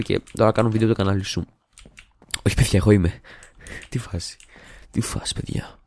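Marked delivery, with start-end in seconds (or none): 3.9-4.4 hushed. Pretend. Hushed greeting, repeated. 4.9-5.8 unvoiced whisper of "Τι φάση, παιδιά. Breathe "Τι φάση, παιδιά.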